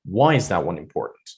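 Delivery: "Why" lifted and punched, the rest falling; stress on WHY. Shown as a demonstration. Teacher asking only about the reason, stress on "Why". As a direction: open hard on "Why" in "Why is that one important". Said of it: "talking about the reason".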